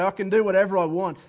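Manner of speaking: loud, pretend self-assertion; mock defiance. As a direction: act out someone loudly claiming free rein. Acts out a defiant self-justifier.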